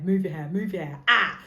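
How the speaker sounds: rising to a loud frustrated cry; frustrated yell. Retold or imitated. Watching her own podcast back, yelling at her hair. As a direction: nag at the hair, then burst out. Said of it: "I'm like".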